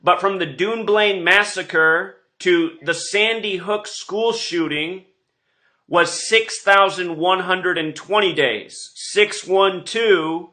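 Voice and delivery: usual speaking voice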